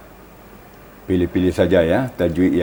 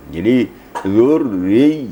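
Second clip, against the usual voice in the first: "zurri" slow, r pressed hard; emphasis demo; pressed doubled r; 3 semitones higher, 3 dB louder, much slower than usual. Shown as a demonstration. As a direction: lean on the tasydid in "zurri". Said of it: "ada penekanan".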